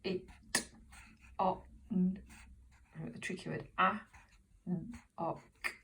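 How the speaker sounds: each sound said separately and softly; phonics sounding out. Shown as a demonstration. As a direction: sound out "Sit on" phoneme by phoneme, with long gaps. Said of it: "how we blend those phonemes".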